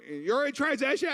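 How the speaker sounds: high, loud announcing voice; hammed-up high delivery-room call; mock midwife call. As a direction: call it out like a labor coach. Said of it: "you know".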